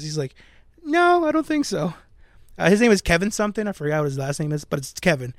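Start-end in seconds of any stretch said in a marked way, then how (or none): 0.8-1.9 dismissive high mock voice. Dismissive writer, quoted high.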